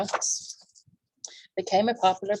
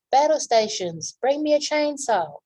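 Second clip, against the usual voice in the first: panicked orders; 8 semitones higher, 5 dB louder than usual. Acted.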